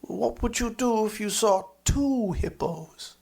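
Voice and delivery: a hint of a gleeful child; child's question. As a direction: top the last question like a boy upping the stakes. Child asks the question again.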